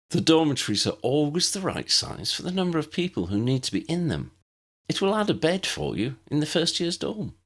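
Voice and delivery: put-on a patient older pupil showing the new girl around; Tessa the friendly older student. Tessa voiced in the story.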